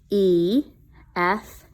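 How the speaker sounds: ordinary talk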